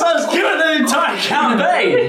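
high, delighted shout through laughter; laughing triumph. Real, not acted. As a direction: gloat happily while laughing.

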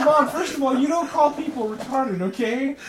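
loud, high, laughing scolding; mock lecture to mom. Son scolding his mom loudly while laughing. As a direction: scold her through laughter.

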